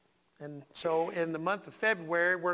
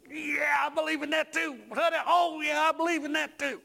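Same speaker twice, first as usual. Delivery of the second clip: high-pitched, eager exclamation; enthusiastic agreement; 7 semitones higher than usual. Acted. Preacher acting out his response to a favourite song, enthusiastic. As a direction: chime in keenly, pitched well up.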